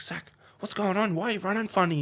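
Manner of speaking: put-on a team runner checking on a hurt kid; high, anxious check-in from the sidelines; concerned runner. Runner checks on injured player.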